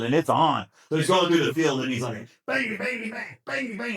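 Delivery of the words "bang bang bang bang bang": high-pitched, rapid-fire mock shots; cocky pretend gunfire. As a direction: fire imaginary rounds with cocky gusto. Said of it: "he starts getting cocky".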